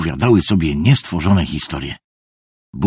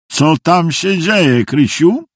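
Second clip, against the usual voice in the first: plain reading voice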